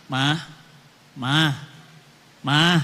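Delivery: low, drawn-out coaxing calls to his wife; a hint of a pleading husband. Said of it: "Suaminya ngajak".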